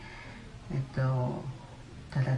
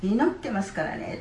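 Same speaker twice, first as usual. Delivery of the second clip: higher, called out with a big voice; loud pastor's voice; 6 semitones higher than usual. Retold or imitated. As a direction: announce it boldly so the whole room hears. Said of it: "って大きな声で言われた".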